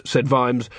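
ordinary delivery, nothing marked

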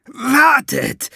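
high, loud squawk of a wailing dwarf; dwarf clamouring for them to stop; shrill protest. A narrator's reading. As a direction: screech wait like a cornered dwarf. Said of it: "zeterte der Zwerg".

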